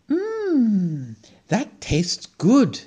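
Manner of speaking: high mmm then slow, savoring praise; delighted squirrel tasting. A narrator's reading. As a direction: savor the seed, slowly. Squirrel tastes seed.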